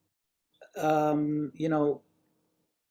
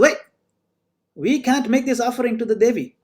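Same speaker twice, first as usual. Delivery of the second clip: higher, louder acted objection; urgent halting call; 7 semitones higher, 5 dB louder than usual. Cannibal priest stopping the offering to Devi, urgent call. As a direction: call out to halt everything, mildly acted.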